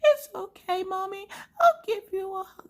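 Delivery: high, squeaky imitated child voice; put-on small kid voice, high and sweet; little daughter comforting. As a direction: console mom as a tiny girl would. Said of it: "And she's like".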